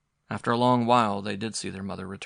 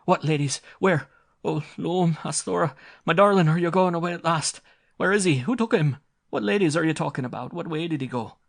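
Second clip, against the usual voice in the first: high, loud, frantic questions; mother desperate for her lost child; distracted cry; 9 semitones higher, 8 dB louder than usual. A narrator's reading. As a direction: pour out panicked questions. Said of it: "she cried in distraction".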